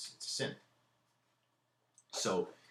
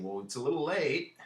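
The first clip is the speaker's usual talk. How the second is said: higher, louder dry mock retort; sarcastic aside; 7 semitones higher, 5 dB louder than usual. Readers scoffing at Hamlet, sarcastic and raised. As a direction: roll your eyes at him out loud.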